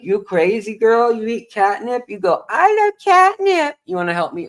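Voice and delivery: put-on high, sing-song baby talk to a pet; cat baby talk. Owner teasing his cat in high baby talk.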